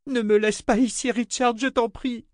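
very high, frantic woman's voice; panicked pleading. A narrator's reading. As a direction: beg at the end of your nerves. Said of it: "cria Jensen à bout de résistance nerveuse".

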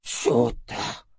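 drawn-out deflated exclamation; soupir accablé. A narrator's reading. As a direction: let it out like a deflating balloon. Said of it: "émit un soupir de baudruche qui se dégonfle". (soupir de baudruche qui se dégonfle)

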